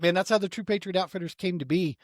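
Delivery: ordinary talk, nothing marked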